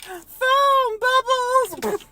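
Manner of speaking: put-on very high, very slow delighted child voice; excited kid. Kids at the beach finding foam, high slow delighted squeal. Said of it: "little kids ... they're like".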